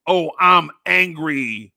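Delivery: loud, drawn-out mock outrage; hammed-up, slow angry bellow. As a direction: imitate someone making a show of anger. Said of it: "angry perception, like".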